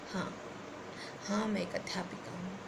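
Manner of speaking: near-whispered poetic admission; hushed refrain. Acted. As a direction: let the refrain sink almost to a whisper. Poem's regretful refrain, dropped low.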